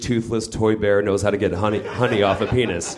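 usual speaking voice

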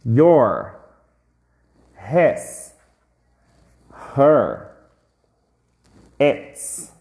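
each word said alone, with a pause after it; slow teacher drill, word by word; drilled word list. Shown as a demonstration. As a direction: model every word for the class to repeat. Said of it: "Repete comigo".

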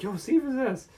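high, loud panicked plea; frantic mom. Retold or imitated. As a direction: shriek it like a desperate mother. Mom begging Wonka.

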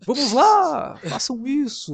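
high, haughty English lord. Retold or imitated. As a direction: command like an aristocrat. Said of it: "ele fala como um Lord inglês".

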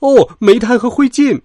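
high, loud exclamation; little engine yelling in dismay; dismayed cry. A narrator's reading. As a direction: cry out as if suddenly covered in soot. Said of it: "托马斯大叫".

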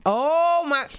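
surprised. Real, not acted. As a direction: exclaim in surprise.

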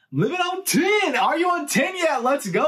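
giddy victory call, way up high; excited hype. Retold or imitated. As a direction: shout it like he just escaped disaster. Acted hype while racing to car.

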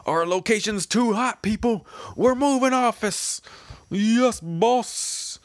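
high voiced manga character. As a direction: bark it out as a pumped-up crew member. Manga reader voicing characters.

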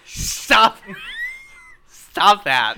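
loud, laughing protest; cracking up while telling him to stop. Real, not acted. Player begs the GM to stop the noises, loud and laughing.

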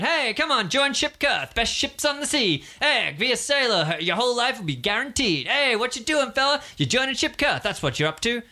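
high, eager sales patter; excited recruiter pitch. Acted. Shipkarth recruiter to the crowd, high eager pitch. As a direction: sell it to everyone who walks by. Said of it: "he's very, very excited".

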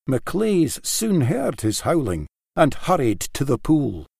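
plain reading voice

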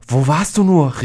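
high, loud alarmed cry; calling out. A narrator's reading. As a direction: cry out in shock at a ghost. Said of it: "rief sie".

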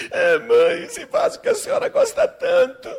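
put-on high-pitched childish crying voice; sobbing little girl. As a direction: confess through hammed-up sobs. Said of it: "a menina chorando".

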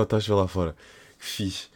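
normal talking voice

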